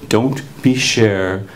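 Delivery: low, slow, gentle warning; lightly voiced protective teacher. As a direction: drop down and lay it on slowly, kindly. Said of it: "he says, in the same tone".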